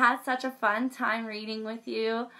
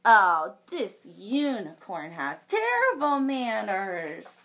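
lively storybook read-aloud with big reactions; reading to children; 2 semitones higher, 6 dB louder than usual. A narrator's reading. Librarian reading a unicorn story to children, animated. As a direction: read it like a picture book for kids.

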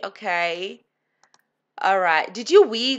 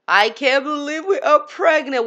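higher and louder, thrilled; squealing that it's real; excited disbelief; 6 semitones higher, 4 dB louder than usual. Real, not acted. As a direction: blurt it out in delighted shock. Sims pregnancy surprise.

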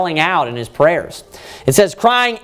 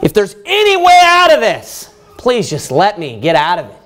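raised, pleading cry that dips on the please; desperate plea; 5 semitones higher than usual. Acted. Preacher paraphrases Jesus' garden prayer, pleading aloud. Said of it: "you know what he was saying".

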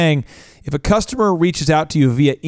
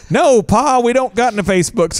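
put-on higher twangy kid answer; country kid; 7 semitones higher than usual. Kid tells Pa they have no Facebook, higher twang.

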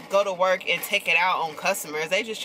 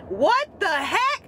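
disbelief; 11 semitones higher, much slower than usual. Retold or imitated. Disbelief retold.

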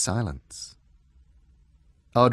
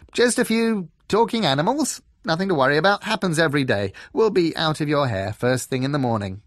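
bright fox voice; 7 semitones higher, 6 dB louder, faster than usual. A narrator's reading. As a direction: brush it all off brightly. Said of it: "said the fox brightly".

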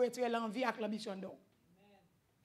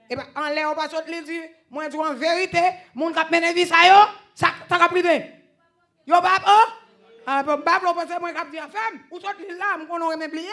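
very loud, high preaching; preaching at full force; 6 semitones higher, 12 dB louder than usual. Acted. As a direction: shout the sermon to the room.

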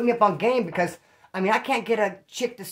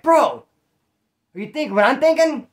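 higher and louder, leaning in with dread; excited loud ominous question; 6 semitones higher, 6 dB louder than usual. Retold or imitated. Cellmate to storyteller over the broken ID card, excited and loud. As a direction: hype it up like a big reveal.

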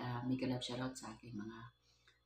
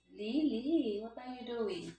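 higher, singsong call to the cat; high pet voice; 8 semitones higher, slower than usual. Real, not acted. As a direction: coo at the cat to get its attention. Talking to playful cat.

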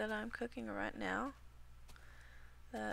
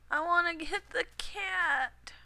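higher, louder, slow eager exclaim; eager squeal; 8 semitones higher, 7 dB louder, slower than usual. Real, not acted. Gamer gushing over a cat she spots. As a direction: gush at the cat you want.

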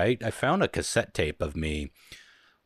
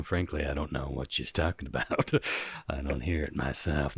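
mock denial in low voice; 6 semitones lower, 6 dB quieter than usual. Jokingly denies resembling the monster's voice.

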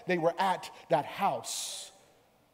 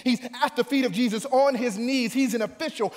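high-energy, raised sermon delivery; full-force preaching; 8 semitones higher, 7 dB louder than usual. Real, not acted. Preacher retelling Jairus pleading for his daughter, full-force preaching. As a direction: build to a loud, high cry of command.

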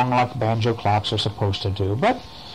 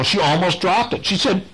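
ordinary delivery

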